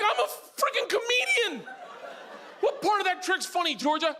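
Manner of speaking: high-pitched comic exasperation; indignant retort. Retold or imitated. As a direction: argue back, hammed-up and high. Acted quarrel with his little daughter.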